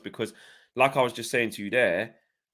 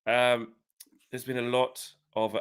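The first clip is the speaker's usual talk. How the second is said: journalist role play; 3 semitones lower, 6 dB quieter than usual. Pretend. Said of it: "literally role play the question".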